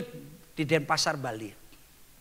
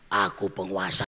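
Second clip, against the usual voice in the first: put-on much lower, gruff man's voice from a girl; demon's male voice; 12 semitones lower than usual. Spirit speaking through the girl, deep male voice. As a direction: speak as the possessing spirit, low and menacing. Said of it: "Suaranya jadi suara laki-laki".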